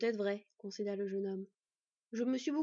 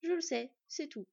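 trying hard to sound sure; confident; 5 semitones higher than usual. A narrator's reading. Young man trying to convince the worried girl, confident. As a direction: close the matter with raised-pitch assurance. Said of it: "s'était efforcé de paraître le plus confiant possible".

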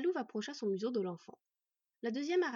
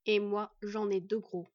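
rumbling voice; 7 dB louder, slower than usual. A narrator's reading. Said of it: "la voix grondante de Fenn".

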